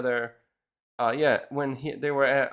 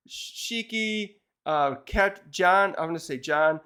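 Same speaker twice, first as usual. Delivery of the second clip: ordinary talk, nothing marked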